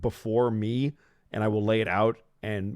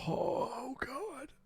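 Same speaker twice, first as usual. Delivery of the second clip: awestruck gasp; 8 dB quieter than usual. Real, not acted. Awestruck at the giant god.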